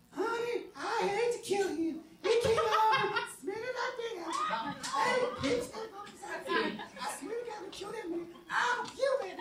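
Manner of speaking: very high falsetto female voice. Pretend. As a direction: play a sweet deadly spider woman.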